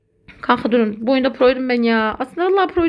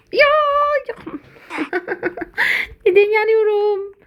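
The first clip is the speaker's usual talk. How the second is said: exasperated laugh; 8 semitones higher than usual. Real, not acted. Gamer dying again, laughing high whine. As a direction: laugh then cry out why.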